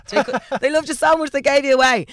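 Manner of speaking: mocking singsong. Mocking the bedtime story line.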